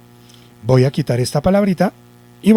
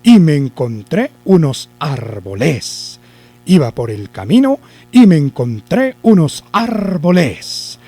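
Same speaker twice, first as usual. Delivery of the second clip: "árboles" stressed on its last syllable, arbo-LÉS; slow sentence, land hard on LES in "árboles"; wrong stress on LES; slower than usual. Shown as a demonstration. Teacher tests the accent on les, stress on LES in "árboles". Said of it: "si la llevara en les".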